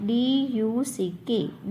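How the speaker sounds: each letter spelled out slowly and separately; slow spelling dictation. Shown as a demonstration. Teacher dictating the spelling of duck to pupils, slowly. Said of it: "तो डक की spelling है".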